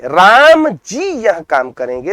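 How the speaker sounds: राम pressed hard, slow and deliberate; stress on राम जी. Shown as a demonstration. Teacher showing students the stress on राम जी. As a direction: lean on राम जी. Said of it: "राम जी पे जो जोर दिया जा रहा है".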